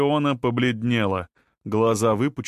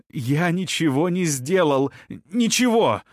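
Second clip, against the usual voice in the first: rising, high cry of denial; desperate to be believed; 6 semitones higher than usual. Acted. Suspect denies, desperate.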